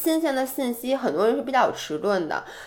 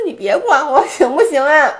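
laughing protest; 6 semitones higher, 7 dB louder than usual. Acted. Mock protest at teasing friend.

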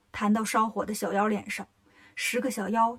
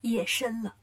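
ordinary delivery, nothing marked

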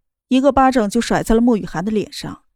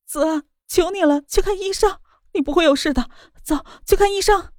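crying; 7 semitones higher than usual. Acted. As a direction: plead through tears, much higher. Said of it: "哭着道". (哭着哀求)

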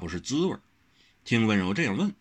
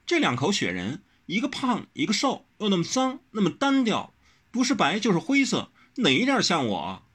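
much higher, insistent; pressing demand; 11 semitones higher than usual. A narrator's reading. Wen Rou grilling Wang Xiaoshi, higher and insistent. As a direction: fire the questions at him. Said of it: "温柔灼灼地问".

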